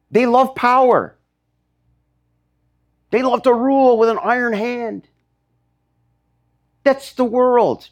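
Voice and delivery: high, emphatic pulpit delivery; impassioned preaching. Real, not acted. Preacher denouncing power-loving rulers to the church, impassioned.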